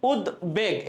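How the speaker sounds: উদ্বেগ with a clear b after the d; sounded ব-ফলা. Shown as a demonstration. Teacher showing students the audible ব in উদ্বেগ. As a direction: say উদ্বেগ as ud-beg, b fully sounded. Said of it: "এখানে কিন্তু বয়ের উচ্চারণ হচ্ছে".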